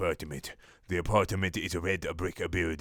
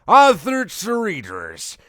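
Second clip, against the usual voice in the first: announcer voice; 11 semitones higher, 10 dB louder, slower than usual. Acted. Mock author announcement.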